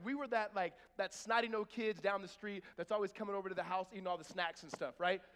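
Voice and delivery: ordinary talk, nothing marked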